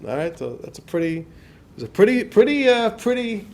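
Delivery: normal talking voice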